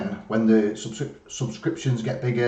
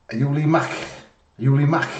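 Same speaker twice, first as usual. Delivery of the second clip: higher-pitched Scouse imitation with an extra throaty tail on "Mack"; Scouse accent; 5 semitones higher, 3 dB louder than usual. Shown as a demonstration. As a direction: mimic a Liverpudlian, scraping the final K. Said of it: "put that little extra bit onto it".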